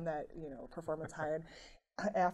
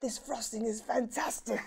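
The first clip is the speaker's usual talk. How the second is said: mock British accent; 5 semitones higher than usual. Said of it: "forgive my horrible British impersonation".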